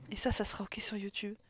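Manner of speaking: soft unvoiced whisper; ironic whisper. Real, not acted. Whispering ironically to chat about YouTube. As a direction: slip it in as a sly aside.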